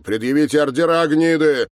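fast, loud, high-pitched demand; angry demand. Acted. Pinned man demanding warrants from officers, angry. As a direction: spit out the demand at top speed.

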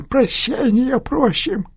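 high, very slow strained plea; wounded man begging. Acted. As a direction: drag out a pained plea.

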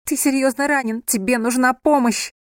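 high, loud insistence; urgent shouting. A narrator's reading. Wife urges wounded husband to accept help, urgent shouting.

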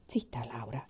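low, soft whispered hush; father quietly silencing his daughter. A narrator's reading. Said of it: "disse papà". (papà che zittisce sottovoce)

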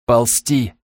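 ordinary delivery, nothing marked

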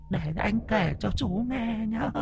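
high, soft, sweet ghostly coo. Acted. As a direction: croon it like a confiding spirit. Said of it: "thổ thỉ".

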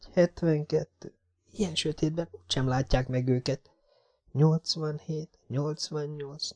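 put-on high, hushed, anxious girl; nervous inner voice. A narrator's reading. Jessica steels herself for five minutes, high and hushed. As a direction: whisper it to yourself, scared. Counting seconds in fear.

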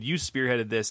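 ordinary talk, nothing marked

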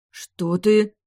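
startled gasp, high and loud. A narrator's reading. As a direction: gasp in shock. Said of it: "ахнула высшая".

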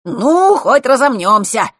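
high, drawn-out shout; loud exclamation. Acted. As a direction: call it out while setting off. Friend shouts while setting off running. (громко, протяжно выкрикивая)

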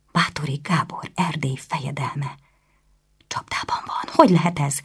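breathy whisper, disbelieving. Acted. Anna, alone with her shock, whispering in disbelief. Anna stunned, murmuring to herself.

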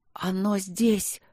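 plain reading voice

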